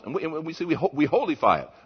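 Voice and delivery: ordinary talk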